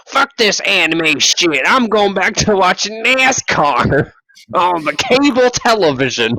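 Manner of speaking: mock rant.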